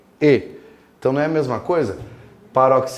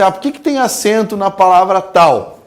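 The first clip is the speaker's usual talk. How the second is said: mock exam question; 5 semitones higher, 5 dB louder than usual. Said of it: "quando você fala assim".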